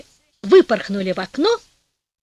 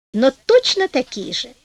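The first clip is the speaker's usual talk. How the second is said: ordinary delivery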